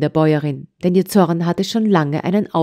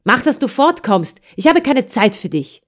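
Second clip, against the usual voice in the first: mild anger; 7 semitones higher than usual. A narrator's reading. Angry farmwife sends child away.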